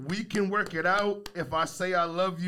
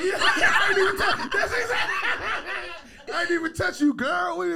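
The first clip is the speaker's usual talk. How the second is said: high, loud laughing shout; 4 dB louder than usual. Real, not acted. Cracking up at the joke.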